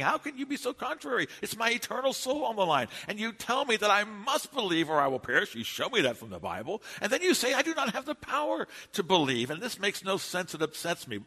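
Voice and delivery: imitating a railing young man, high-pitched; indignant complaining. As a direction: complain like an exasperated churchgoer challenging his pastor. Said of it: "And he's complaining".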